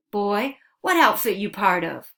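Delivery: loud, harsh barked question; barked demand. Acted. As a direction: bark it at the captive boy. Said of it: "their leader barked".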